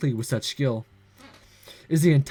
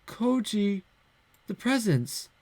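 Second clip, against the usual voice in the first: put-on dismayed girl; 6 semitones higher, 5 dB quieter, slower than usual. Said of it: "in those innocent eyes of hers".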